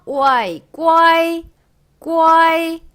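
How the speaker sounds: 오아이 then 꽈이 joined on a high level tone; loud, held 꽈이 built from 오아이; syllable drill. Shown as a demonstration. Teacher blending initial and final, syllable drill.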